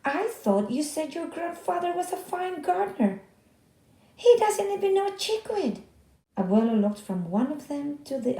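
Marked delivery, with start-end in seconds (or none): 0.0-5.8 high, loud rising fury; angry. Acted. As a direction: build the anger to a shout. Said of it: "mustache quivered with anger".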